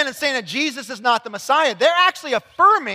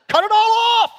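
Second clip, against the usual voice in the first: high, loud burst for Paul's harsh order; mock outburst; 10 semitones higher, 6 dB louder than usual. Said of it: "That's what he's saying".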